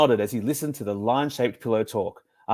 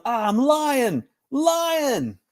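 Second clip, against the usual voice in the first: much higher, slow hammed-up wail; mock yell; 13 semitones higher, much slower than usual. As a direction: ham up a fake lying-down yell. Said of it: "I lay down and yelled".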